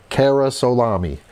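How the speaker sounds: ordinary delivery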